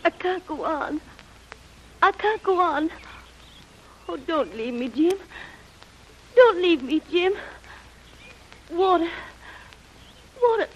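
high, crying pleas for water; a feverish girl begging, near tears; delirious fevered pleading. Acted. Delirious girl begs Jim for water, high and crying. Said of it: "who is delirious with shock and fever".

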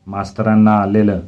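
ordinary delivery

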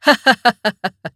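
commander's acted staccato laugh; amused chuckle. A narrator's reading. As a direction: chuckle at the young man's bravado. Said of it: "புன்னகை புரிந்து".

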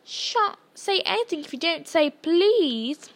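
slow, high teasing singsong; annoying sing-song. A narrator's reading. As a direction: lilt up mockingly to a drawn-out please. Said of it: "his annoying sing-song voice".

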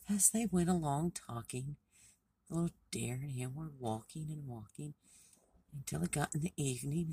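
plain reading voice

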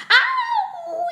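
high, loud cry of pain; long, high-pitched acted yelp; yelled "ow". A narrator's reading. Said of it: "he yelled".

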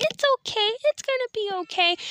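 put-on high, tiny child voice; high little-girl reassurance; little sister's voice. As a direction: mimic a six-year-old comforting. Comforting self as little sister.

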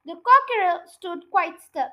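ordinary delivery, nothing marked

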